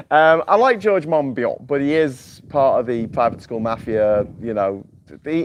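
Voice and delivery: ordinary talk, nothing marked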